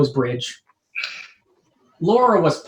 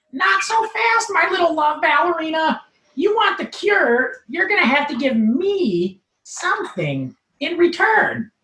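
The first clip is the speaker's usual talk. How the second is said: much higher, smug nerd voice; smug Urkel; 11 semitones higher than usual. Acted. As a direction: gloat like a smug nerd bargaining. Steve bargains smugly.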